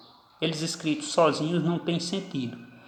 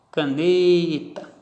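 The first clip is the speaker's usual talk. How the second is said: caneta slow, NE held long; stress on middle syllable; much slower than usual. Shown as a demonstration. Teacher showing penultimate stress, caneta with a drawn-out NE. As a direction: stretch the NE in caneta, let TA drop. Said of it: "E a tonicidade veio para aqui".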